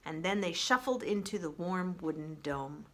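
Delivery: plain reading voice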